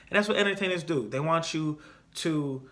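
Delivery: ordinary delivery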